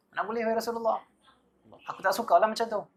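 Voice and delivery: high, indignant companion's refusal; shocked protest. Acted. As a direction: recoil at the very idea. Companion protests again, rising.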